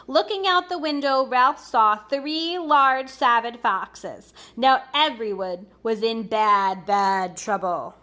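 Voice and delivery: put-on lively read-aloud for children; storybook reading. A narrator's reading. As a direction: read it like a bedtime picture book. Reading a picture book aloud.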